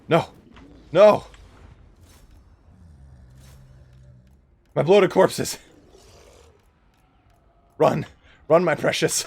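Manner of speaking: loud hammed-up anguished cry; mock despair. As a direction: wail over the lost army. Units dying, mock despair.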